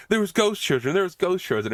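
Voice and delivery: high-pitched, repetitive mock alarm; mock panic. Woman waking her friend to warn her, mock panic. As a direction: imitate a woman in a badly acted panic. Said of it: "she starts panicking".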